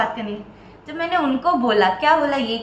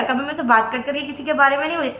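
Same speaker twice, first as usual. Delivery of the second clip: ordinary talk, nothing marked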